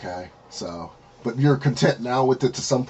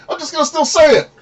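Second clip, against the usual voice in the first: much higher voice; 8 dB louder, faster than usual. Retold or imitated. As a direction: quote jumps up in pitch, squeaky. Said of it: "But then there's moments I'm like".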